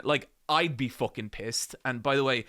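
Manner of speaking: usual speaking voice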